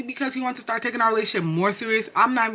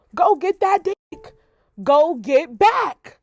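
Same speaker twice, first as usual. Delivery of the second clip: loud, high, very slow taunting; loud drawn-out sarcastic jeer; mocking taunt; 8 semitones higher, 5 dB louder, much slower than usual. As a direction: stretch it out mockingly.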